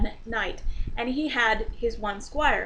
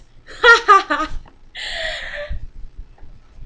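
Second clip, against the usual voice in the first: big laugh; 8 dB louder than usual. Real, not acted. Laughs at own joke.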